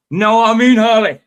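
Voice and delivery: put-on loud, high Scottish accent on the catchphrase; Scottish Frank Bruno. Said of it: "a Scottish Frank".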